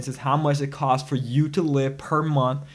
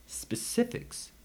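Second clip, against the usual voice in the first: whispered emphasis; 9 semitones higher, 11 dB quieter, much slower than usual. Real, not acted. Stressing specifics.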